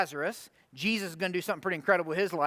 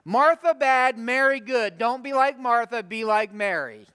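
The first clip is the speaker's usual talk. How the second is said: loud, punched slogan, easing off at the end; mock simplistic moralizing; 3 semitones higher, 6 dB louder than usual. Preacher parroting how the story is usually taught, mock moralizing. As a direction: chant it like a crude moral lesson. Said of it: "I've heard it talked about this way".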